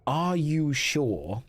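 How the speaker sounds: slow, loaded dungeon-master question. Acted. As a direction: stretch it into a warning question. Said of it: "when the dungeon master says".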